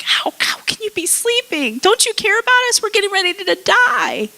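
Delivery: very high, squealed frantic imitation; panicked disciple, played for effect; mock panic. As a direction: plead in hammed-up terror, way up high. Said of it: "the disciples start freaking out".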